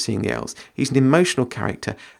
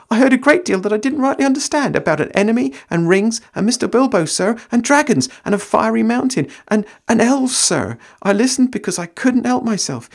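Sam voice; 9 semitones higher than usual. Acted. Sam pleading to see the elves, high and eager. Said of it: "said Sam, dithering".

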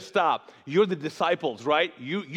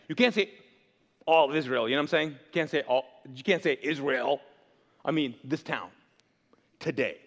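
ordinary delivery

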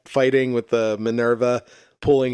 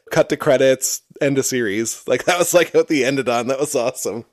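normal talking voice

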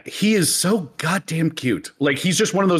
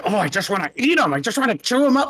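cute aggression voice; 6 semitones higher, faster than usual. Retold or imitated. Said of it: "you'll hear somebody like".

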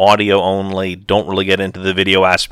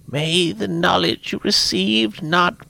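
drained blessing; weary old woman's whisper; exhausted whisper; 10 semitones higher, slower than usual. Acted. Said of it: "continued in an exhausted whisper".

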